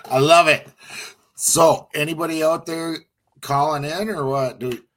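high, loud radio host call-out; rally the listeners out there; excited host. Real, not acted.

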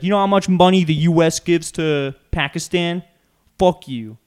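very loud, high mock tirade; imitated furious yell. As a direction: scream it like the ranting guy in the video. Retold rant at Pakistani employee.

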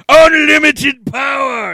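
huge, drawn-out high roar; hammed-up power-mad shout; villain's triumphant shout. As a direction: bellow it slowly, at full force. Villain's sudden reveal, acted out.

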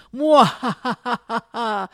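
slow, loud villain cackle; evil villain laugh. Retold or imitated. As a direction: cackle like a cartoon baddie. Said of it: "Beetlejuice says".

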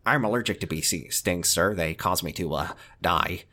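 very low, hushed deadpan delivery; Simpsons character quote. Acted. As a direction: drop the voice low and soft for the quote. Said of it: "another Simpsons joke for you".

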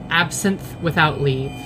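ordinary delivery

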